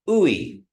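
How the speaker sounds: high gliding ooey; teacher models the ui sound; Kiowa ooey. Shown as a demonstration. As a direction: slide ooey up and down. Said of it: "which creates".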